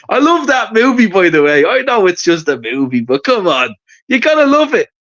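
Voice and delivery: usual speaking voice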